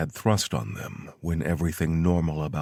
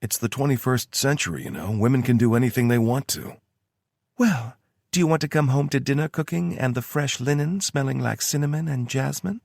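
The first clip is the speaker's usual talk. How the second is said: lightly higher woman's voice; Tara voice; 8 semitones higher, 4 dB louder than usual. A narrator's reading. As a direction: read her lines a bit higher. Tara's voice in story.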